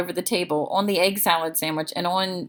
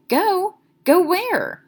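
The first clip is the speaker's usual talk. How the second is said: surprised; 13 semitones higher, 5 dB louder, much slower than usual. Acted. Boy alarmed gang leaving.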